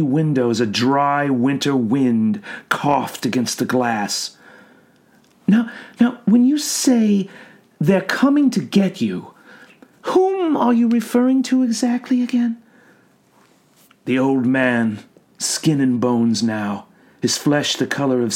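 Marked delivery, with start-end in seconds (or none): 5.5-12.6 soothing clinical questioning; calm doctor voice. A narrator's reading. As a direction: gently probe a patient. Doctor voice in read story.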